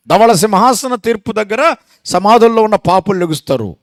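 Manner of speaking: loud, pressing proclamation; preaching at full force. Real, not acted. Preacher declaring the judgment to the church, loud. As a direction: drive the point home.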